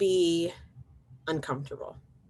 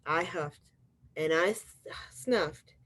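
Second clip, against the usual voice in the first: ordinary delivery, nothing marked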